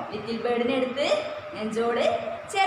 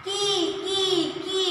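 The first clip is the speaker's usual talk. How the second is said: bird chirp; 5 semitones higher, much slower than usual. Shown as a demonstration. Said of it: "എങ്ങനെയാ little bird sound ഉണ്ടാക്കിയത്?".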